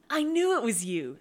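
loud, high, delighted trill. A narrator's reading. Serenity greeting a man she knows, trilling. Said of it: "Serenity trilled".

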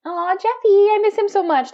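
high, loud cooing at a pet. Real, not acted. Baby talk about the cat.

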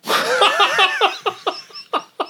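high burst of real laughter; helpless giggle; laughing. Real, not acted. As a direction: crack up at the memory. Laughing at his own story.

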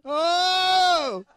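high, loud hammed-up groan; mock groan. Preacher acts the sailors groaning at Jonah, high and loud. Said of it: "It says they groaned".

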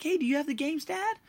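put-on high, eager child voice asking about the games; eager kid. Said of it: "I was like".

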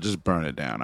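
ordinary talk

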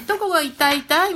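put-on high baby-talk question; high, child-directed questioning lilt; doctor to child. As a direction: imitate a doctor asking a toddler, pitched high. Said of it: "みたいな感じで聞いた".

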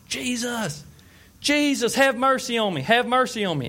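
high, pleading cry for mercy. Retold or imitated. Blind man calling out to Jesus, high pleading cry. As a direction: call out desperately to Jesus, pitched high. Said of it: "this blind man starts crying out".

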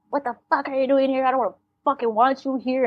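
normal talking voice

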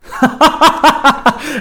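loud, high, uncontrolled burst of laughing; harsh laughter that will not stop; shout of laughter. A narrator's reading. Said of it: "burst into a shout of laughter".

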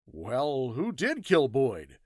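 slow, puzzled question; a touch of astonishment, pitch swooping; amazed. Acted. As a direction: draw out the question in mild amazement. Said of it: "asked Hatch, amazed".

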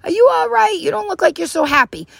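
high, concerned check-in; upbeat, caring hello; friendly connection. Acted. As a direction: check on her kindly, pitched well up. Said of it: "instead, I connect".

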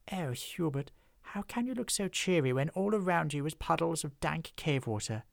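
high, posh-sounding complaint; refined lady troll. Acted. Lady troll complaining to her husband about the damp cave, high and refined. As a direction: sigh it out genteelly.

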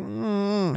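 high, soft grumbling hum; softly mimicked unhappy "mm"; upset whine. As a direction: imitate a child's wordless protest. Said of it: "he was upset".

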